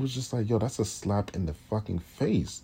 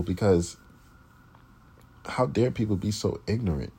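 ordinary talk, nothing marked

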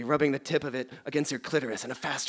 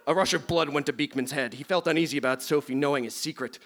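ordinary talk, nothing marked

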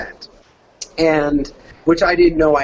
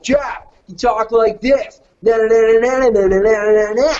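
higher-pitched party dude babble; party guy voice; 3 semitones higher than usual. Shown as a demonstration. Demonstrating his failed audition for Jeff, party guy. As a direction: push the voice up into a rowdy party guy. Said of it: "my party guy who was like".

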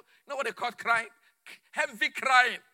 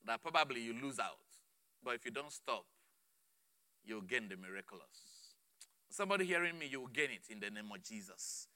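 very soft and low; hushed preaching; 12 semitones lower, 11 dB quieter than usual. Real, not acted. Preacher promising a listener the miracle, hushed. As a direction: sink to a near-whisper of assurance.

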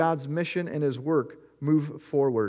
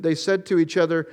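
ordinary delivery

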